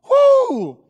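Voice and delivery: very high, loud "whoo"; triumphant whoop. Acted. Preacher capping Peter's boast, triumphant whoop. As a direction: let out a piercing whoop.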